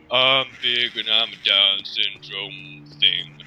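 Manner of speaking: very slow, lumbering oaf voice; slow-witted cartoon lug; Big. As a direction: drag every word out heavily. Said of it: "Then Big appears and goes".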